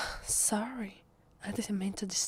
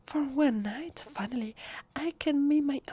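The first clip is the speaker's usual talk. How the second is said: rising louder and higher, then cut off; excited outburst; 6 semitones higher, 6 dB louder than usual. Acted. Halloween fan nearly revealing a secret, excited outburst. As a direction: get carried away, then stop short. Blurts out joy, cuts off.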